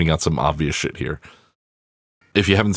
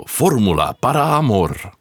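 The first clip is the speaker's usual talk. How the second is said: higher, hammed-up Latin American newscaster saying Fórmula para Amor; Spanish newscaster voice; 6 semitones higher than usual. Podcast host repeating the Latin title, mock Latin American newscaster. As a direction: give the title a Latin American news anchor's flourish. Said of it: "my Spanish newscaster voice".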